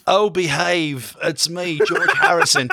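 British ghost doing Austin Powers; hammed-up groovy British accent; Austin Powers impression. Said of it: "then he's gonna do Austin Powers".

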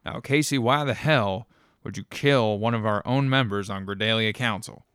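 loud, accusing snap at Casey; snapping in anger. Acted. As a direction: bite every word at her, loud. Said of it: "he snapped at Casey".

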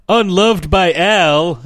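high, loud, drawn-out cartoon reading; Homer. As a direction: read it out slowly as a mock cartoon character, falling at the end. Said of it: "a Simpsons version of this where Homer".

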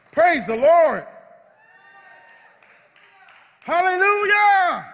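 high, ringing shout of praise; a joyful pulpit cry of Hallelujah. Real, not acted. Preacher's ecstatic praise.

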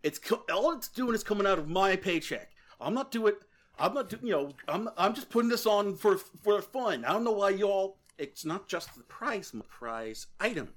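put-on indignant young gym leader arguing back; anime rival. GM voices Ethan defending himself.

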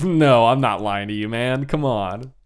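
mock-offended denial; exaggerated denial. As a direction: protest innocence a bit too hard. Dwarf acting out a protesting denial.

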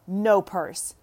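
ordinary talk

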